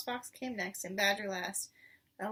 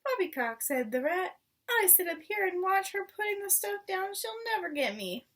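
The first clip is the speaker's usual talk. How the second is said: high sneering rat voice; the rat dismissing the poison; scoffing rat; 8 semitones higher than usual. Acted. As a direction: scoff from up on the shelf.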